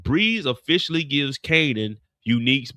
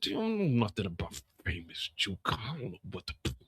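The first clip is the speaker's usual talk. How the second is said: much softer, low, quick mumble; mumbled Kanan voice; 7 semitones lower, 11 dB quieter than usual. Retold or imitated. Imitating Kanan telling Juke about the cops, low mumble. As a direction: mumble it under your breath. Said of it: "The cops said".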